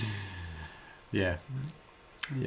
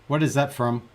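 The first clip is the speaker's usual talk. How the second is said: ordinary delivery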